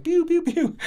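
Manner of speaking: high playful finger-gun pews; pew pew gun noises. Retold or imitated. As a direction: mimic toy gunshots, high and light. Finger gun spy play.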